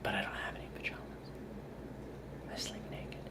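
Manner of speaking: very soft whisper; hushed confidential whisper; whispered aside. Real, not acted. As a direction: whisper it like a secret confession.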